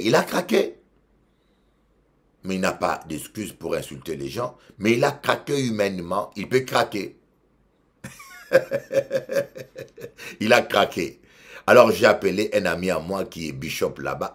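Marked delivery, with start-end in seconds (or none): 0.0-0.8 much higher, louder exclamation; emphatic cry. Real, not acted. Preacher insisting emphatically. 8.0-10.3 laugh. Real, not acted. Laugh heard.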